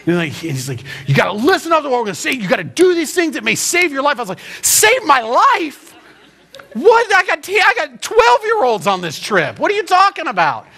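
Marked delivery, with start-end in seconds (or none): 1.1-4.2 fast, higher-pitched urgent instructions; mock drill sergeant. River guide briefs the rafters, imitated drill-sergeant urgency. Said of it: "this river guide, like, slash drill sergeant". 4.6-10.7 high, hammed-up incredulous outburst; mock panicked disbelief. Pastor with twelve-year-olds answers the guide, comic panic.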